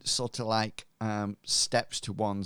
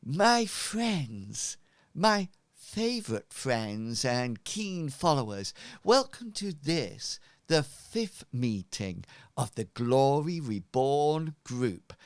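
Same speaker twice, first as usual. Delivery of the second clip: grand cult leader; 4 semitones higher than usual. Acted. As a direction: greet your flock with pompous warmth. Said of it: "And he says".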